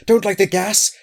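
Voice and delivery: high, loud, urgent panic; frightened outburst, pitched right up; alarmed cry. A narrator's reading. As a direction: cry out to stop him at once. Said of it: "he cried out".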